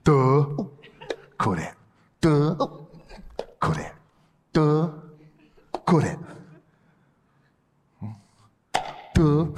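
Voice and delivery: short dull duh thrown away; thrown voice grunt. Shown as a demonstration. Ventriloquist bouncing his voice around the room, dopey. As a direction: toss a dopey duh off to the side. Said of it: "I'd better do that for you today".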